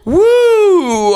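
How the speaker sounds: loud, high drawn-out woo; loud whoo. Real, not acted. Comic flustered by the suggestion, loud whoop. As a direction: whoop out the nerves.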